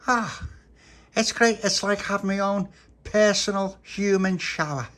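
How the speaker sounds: high, loud, gleeful; delighted. Acted. Tony having jam sucked off his cheek, high loud delight. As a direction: squeal with childish delight.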